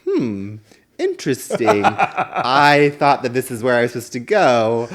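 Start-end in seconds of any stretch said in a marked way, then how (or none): 0.0-1.8 wry interesting. Retold or imitated. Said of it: "how I'm like".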